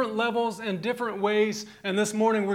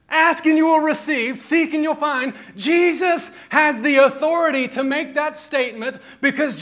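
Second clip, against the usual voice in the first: loud, high full-force preaching; fiery preaching; 5 semitones higher, 7 dB louder than usual. Acted. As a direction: drive it home to the congregation.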